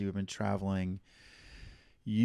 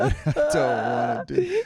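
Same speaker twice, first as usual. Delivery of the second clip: loud, slow, higher mock whine; mock whining; 5 semitones higher, 7 dB louder than usual. Host teases co-host about dreading the reunion, in a mock whine. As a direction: drag it out as an exaggerated complaint.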